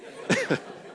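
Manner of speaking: short unvoiced chuckle; quick airy laugh; breathy laugh. Real, not acted. Laugh alone.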